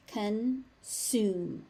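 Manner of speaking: "Consume" split as con... sume; syllable by syllable. Shown as a demonstration. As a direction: pause between the halves of "Consume". Teacher breaks word into syllables.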